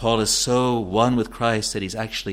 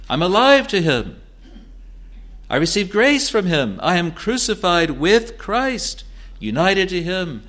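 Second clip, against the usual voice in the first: raised, ringing pulpit voice, each phrase falling away; fervent preaching; 8 semitones higher than usual. Acted. Preacher declaring union with Christ to the congregation, fervent and raised. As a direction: preach it with rising conviction.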